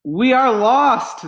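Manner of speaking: high, loud and slow cry of despair; despairing wail. Acted. Townspeople giving up before the pasta, despairing wail. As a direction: wail it as the town gives up. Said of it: "said the people".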